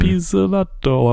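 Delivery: slow, exaggerated Italian accent on Pizzolatto. Shown as a demonstration. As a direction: stretch Pizzolatto out like a cartoon Italian. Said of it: "I wanna say it in a stupid Italian accent".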